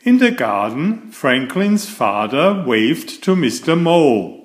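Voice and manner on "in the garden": ordinary delivery, nothing marked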